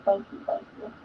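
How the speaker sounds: breathy whispered thank yous; soft whispered gratitude. Real, not acted. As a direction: murmur it under your breath.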